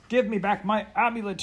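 high, loud furious demand; snatch at it with a yell; fierce cry. A narrator's reading. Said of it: "he cried".

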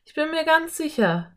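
put-on high-pitched girl character; eager girl. A narrator's reading. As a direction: voice a keen girl, pitched well up. Narrator voices the girl Anne.

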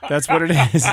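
usual speaking voice

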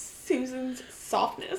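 slow, soft, savoring echo; soft slow mock-seductive repeat; mock-sultry echo. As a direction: linger on it teasingly. Mocking a blurb phrase.

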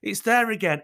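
ordinary talk, nothing marked

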